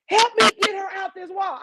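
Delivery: high, loud shout for help; yelling aunt. Retold or imitated. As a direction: bark the order like a panicked aunt. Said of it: "Auntie Rob, was like".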